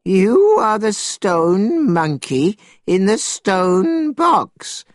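put-on high, drawn-out old man's amazement; astonished old man in a story; great surprise. A narrator's reading. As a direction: stretch it out slowly, high-pitched and astonished. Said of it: "said the old man in great surprise".

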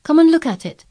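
high, loud eager call. A narrator's reading. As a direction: call her over, excited. Boy eagerly calls her over.

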